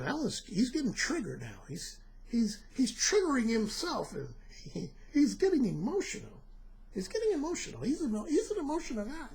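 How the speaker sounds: hushed, mocking critic voice. Mocking critics who call him emotional, hushed mimicry. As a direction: mimic the doubters under your breath. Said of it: "you know somebody right now saying".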